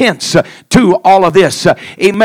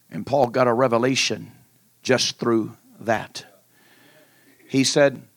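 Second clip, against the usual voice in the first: hushed sermon; 10 semitones lower, 10 dB quieter than usual. Acted. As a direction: draw the church in quietly. Soft, hushed intimate preaching.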